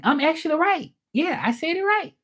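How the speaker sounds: high, squealing with joy; thrilled to have got it right; delighted. Real, not acted. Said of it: "I'm happy".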